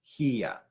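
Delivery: "hia" as HEE-a in two syllables; separated vowels. Shown as a demonstration. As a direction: keep I and A apart in "hia". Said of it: "to make separate vowel sounds".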